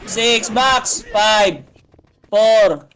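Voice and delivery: ordinary delivery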